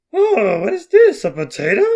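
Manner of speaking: high, loud cartoonish uncle; goofy uncle character. Voices Uncle Brewston in script.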